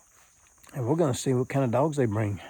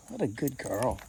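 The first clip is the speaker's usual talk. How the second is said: soft cooing praise; 16 dB quieter than usual. Real, not acted. Cooing to the dog.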